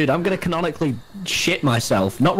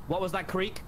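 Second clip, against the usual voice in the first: ordinary talk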